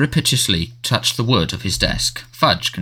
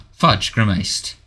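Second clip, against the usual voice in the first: plain reading voice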